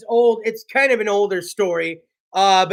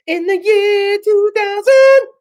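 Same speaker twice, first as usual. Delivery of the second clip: high, slow, booming sing-song; slow high mock-dramatic chant; Conan year two thousand bit; 10 semitones higher, 5 dB louder, slower than usual. Shown as a demonstration. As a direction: intone it like Conan's sketch. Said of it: "I gotta do the second part".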